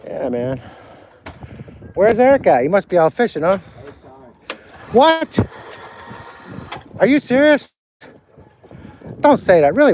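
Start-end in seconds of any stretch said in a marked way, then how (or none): 4.9-9.9 sharp high rise of real shock; shocked disbelief. Real, not acted. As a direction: react in stunned disbelief. Hearing shocking news of friend.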